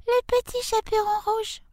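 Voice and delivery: put-on tiny squeaky little-girl falsetto, softly; wolf's put-on high child voice; wolf imitating girl. A narrator's reading. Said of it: "qui était très doué pour les imitations".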